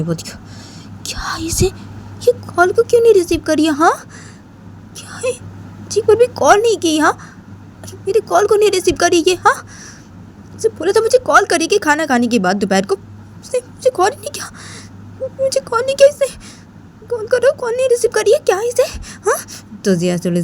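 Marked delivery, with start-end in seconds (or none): 1.1-12.9 put-on a young girl pitched way up, sulky and demanding; high girlish pout, nagging; Jiya's complaining voice. Narrator voices Jiya complaining. 13.4-14.5 very high, crying complaint; high sob-tinged whine; whiny near-tears. Acted. Jiya upset that friend didn't call. 15.4-19.4 put-on a young girl pitched way up, sulky and demanding; high girlish pout, nagging; Jiya's complaining voice. Jiya keeps complaining.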